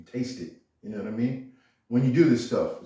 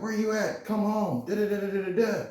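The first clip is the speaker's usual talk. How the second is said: mimicking the girlfriend, much higher and nagging; mock her nagging call in a high voice; 10 semitones higher than usual. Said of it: "She's like".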